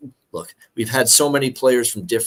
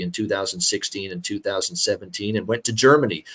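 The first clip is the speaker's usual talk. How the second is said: ordinary talk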